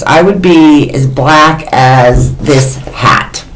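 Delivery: ordinary delivery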